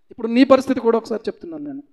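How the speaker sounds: normal talking voice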